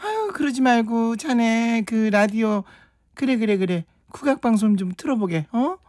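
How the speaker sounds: high-pitched fussy scolding voice; nagging skit character. Acted. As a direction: scold both squabblers in a high character voice, then coax. Voicing another nagging skit character.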